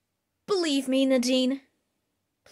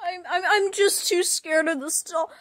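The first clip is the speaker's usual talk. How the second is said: ordinary talk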